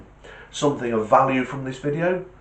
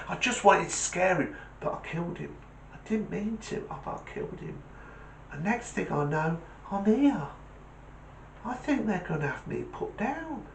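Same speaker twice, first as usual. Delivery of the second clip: higher, softer small-dog confession; quiet, higher-voiced terrier; remorseful little terrier; 5 semitones higher, 5 dB quieter than usual.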